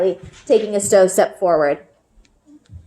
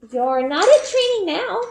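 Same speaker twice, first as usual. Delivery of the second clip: put-on high, pointed little girl voice; a child making her point with a pout; little sister Eliza; 11 semitones higher than usual. A narrator's reading. Little sister pushing her doll at Bryn, high pouting child voice.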